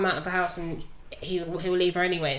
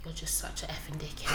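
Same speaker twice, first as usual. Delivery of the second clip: quiet insult; 5 semitones lower, 10 dB quieter than usual. Retold or imitated. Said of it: "she stopped and she went".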